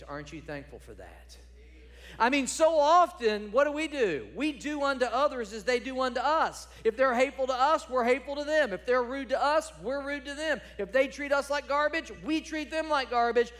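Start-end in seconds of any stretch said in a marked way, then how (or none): 2.2-13.5 loud, high, driving sermon voice; full-force preaching. Real, not acted. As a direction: preach it hard to the congregation.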